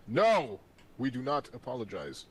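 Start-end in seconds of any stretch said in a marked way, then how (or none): 0.1-0.6 sharp no. Pretend. Scolds apologizing partner.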